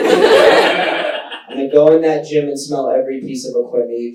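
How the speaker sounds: low-pitched, over-the-top creepy threat; mock menace. Man called a creep imagining his revenge, mock menacing. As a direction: drop low and vow comic revenge. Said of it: "That's how I felt when she called me a creep".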